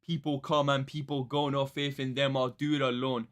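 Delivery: lightly put-on British grime accent, rapping the lyric; half-spoken British MC delivery; a hint of a UK grime accent; mock British accent. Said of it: "the most posh British thing ever".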